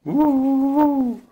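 high, loud, long hoot; echoed wolf howl. A narrator's reading. Stones answering the wolf from seven sides, high echoing howl. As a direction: send the howl back as an echo. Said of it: "klonk het van zeven kanten".

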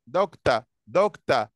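"دكتور" said as dokta, ending on a with no r; ending in a, not o. Shown as a demonstration. Teacher explains the ending sounds like a, dokta. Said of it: "أكنها أ، مش أو".

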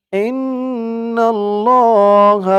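ইন্নাল্লাহ with the nn humming through the nose; held ghunna. Shown as a demonstration. Teacher demonstrating the obligatory ghunna. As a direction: hold the nasal nn in ইন্নাল্লাহ. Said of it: "ওয়াজিব গুন্না".

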